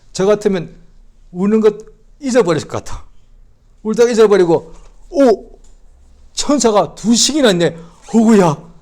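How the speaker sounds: high and loud, acting it out with gusto; animated preaching voice; loud lively preaching. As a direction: dramatize the scene for the congregation.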